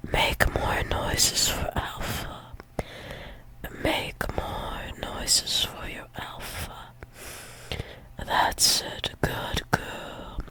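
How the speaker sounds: very soft, slow whisper; hushed, breath-only coaxing; intimate whisper. Pretend. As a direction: whisper tenderly, right up close. Intimate murmuring to a partner.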